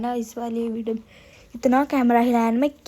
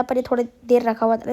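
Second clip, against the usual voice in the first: ordinary talk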